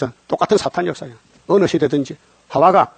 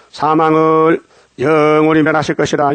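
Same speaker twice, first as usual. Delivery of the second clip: solemn scripture reading; 3 dB louder, slower than usual. Acted.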